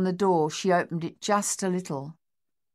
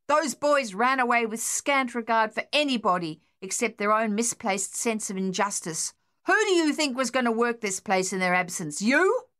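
loud, higher, scornful; angry shouting; 5 semitones higher, 4 dB louder than usual. A narrator's reading. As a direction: bellow at his wife.

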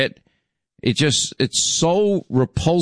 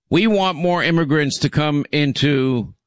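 ordinary talk, nothing marked

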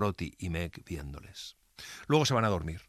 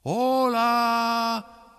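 long, high, held holler; huge echoing call, stretched out; colossal shout; 13 semitones higher, 8 dB louder than usual. A narrator's reading. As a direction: bellow a hello up into the bell tower. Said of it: "profiere un colosal".

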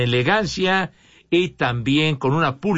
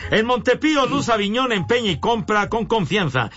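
higher-pitched radio spot; ad read; 4 semitones higher than usual. Real, not acted. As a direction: sell it like a sponsor announcement. Reads a sponsor spot.

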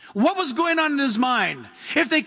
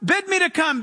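Peter's plea; 6 semitones higher than usual. Retold or imitated. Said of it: "and he says".

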